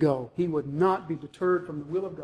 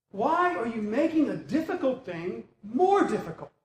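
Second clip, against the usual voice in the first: exasperated; 5 semitones higher than usual. Acted. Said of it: "He finally breaks".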